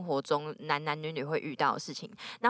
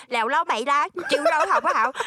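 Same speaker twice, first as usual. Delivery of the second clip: put-on Vietnamese accent in Mandarin; 8 semitones higher, 6 dB louder than usual. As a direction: imitate a Vietnamese waiter's Mandarin. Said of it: "学越南人说中文的时候".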